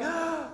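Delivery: sharp high gasp of fake delight; mock gasp. Wife pretending to be thrilled by the blender, mock gasp. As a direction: gasp as if overwhelmed with joy.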